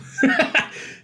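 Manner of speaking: real breathy burst of laughter. Real, not acted. Coach laughing at own monotone demonstration, amused laugh. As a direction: crack up briefly.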